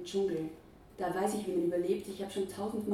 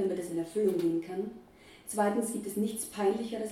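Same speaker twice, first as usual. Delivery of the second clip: ordinary delivery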